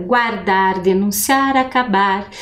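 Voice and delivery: "guardar, denunciar, acabar" with the last syllable of each word leaned on; stressed syllables. Shown as a demonstration. Teacher showing the class the strong syllable in each word. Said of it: "a sílaba mais forte no bar".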